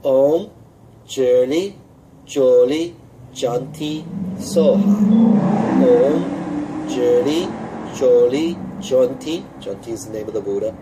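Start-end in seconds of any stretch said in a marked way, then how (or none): 0.0-9.4 each syllable called out loud and rising; teacher projecting the syllables one by one; loud syllable demo. Shown as a demonstration. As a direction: call out each syllable separately. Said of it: "syllable by syllable".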